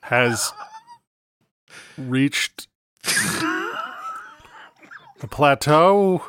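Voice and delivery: slow, cracking up mid-sentence; holding back laughter. Real, not acted. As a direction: fight the giggles, pausing. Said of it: "can't even finish the fucking sentence without cracking up".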